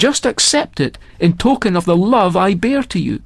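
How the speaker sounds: lightly voiced old pearl diver, moved; grieving old father. Old diver explains son's sacrifice.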